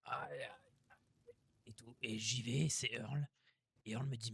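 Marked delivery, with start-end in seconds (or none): 0.0-1.3 mock pained moan. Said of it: "j'entends la radio".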